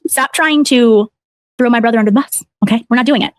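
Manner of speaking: normal talking voice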